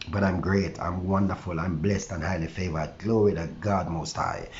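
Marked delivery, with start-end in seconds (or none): none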